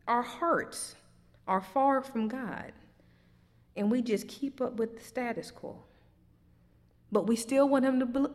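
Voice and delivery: ordinary talk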